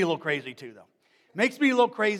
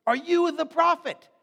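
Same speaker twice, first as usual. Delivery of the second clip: loud, higher, pressing question; demanding question; 8 semitones higher, 7 dB louder than usual. Retold or imitated. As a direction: press him for an answer. Leaders questioning John.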